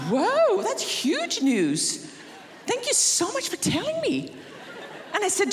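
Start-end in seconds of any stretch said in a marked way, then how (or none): 0.1-4.4 high, drawn-out fake wonder; mock amazement. Mother playing along with her son's plan, high mock wonder. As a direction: gush as if it's the biggest news.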